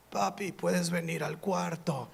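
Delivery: put-on slow, soft little girl voice through a monitor; a small girl calling eerily from far away; creepy child voice. Daughter calling her dad through the baby monitor, soft and eerie.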